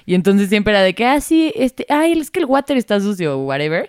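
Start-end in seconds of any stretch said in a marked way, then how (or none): 1.0-3.4 higher, airy, offhand quote; tossing it out like it's normal; casual younger self. Retold or imitated. Recalling how she talked at home as a girl, breezy and higher.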